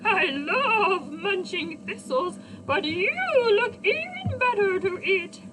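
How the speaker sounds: a greedy goat eyeing a snack; bleating goat. A narrator's reading. Narrator voicing the hungry goat to children. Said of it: "bleated the goat".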